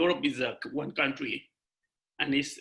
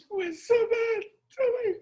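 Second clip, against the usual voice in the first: crying. Real, not acted. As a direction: voice cracks much higher into real tears. Describing mother's condition, crying.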